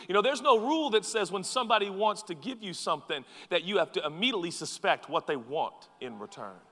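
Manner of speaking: usual speaking voice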